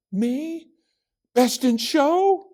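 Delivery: high, stretched, disbelieving questions; amazed. A narrator's reading. Judy learns she won a ribbon, high and amazed. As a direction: squeak it out in happy disbelief.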